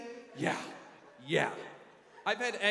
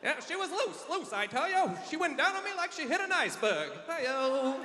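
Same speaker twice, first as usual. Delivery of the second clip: vaudeville comic; 8 semitones higher than usual. Pretend. Vaudeville joke voice.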